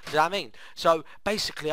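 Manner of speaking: usual speaking voice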